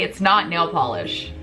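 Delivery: ordinary talk, nothing marked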